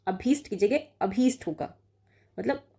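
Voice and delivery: ordinary talk, nothing marked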